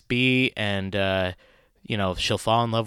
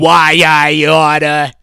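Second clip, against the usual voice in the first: loud, slow Brooklyn tough guy; Brooklyn accent; 2 semitones higher, 12 dB louder, slower than usual. Retold or imitated. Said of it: "speak with, like, a Brooklyn accent".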